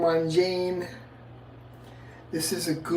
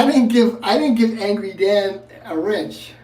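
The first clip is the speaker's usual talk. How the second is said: raised, high slurred protest; 4 semitones higher, 7 dB louder than usual. Real, not acted. Drunk streamer ranting at chat.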